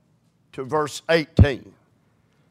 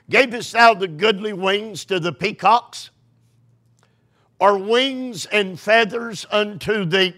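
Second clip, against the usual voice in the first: scripture reading; 4 semitones higher than usual. Acted. Reads scripture aloud, raised.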